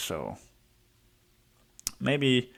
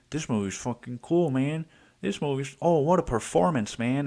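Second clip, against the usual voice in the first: higher, gushing fan voice, mildly hammed up; gushing movie fan; 4 semitones higher than usual. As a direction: play the dazzled fan raving about the performance. Imitating a hyped Joker fan.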